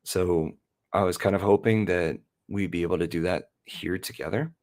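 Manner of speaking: ordinary delivery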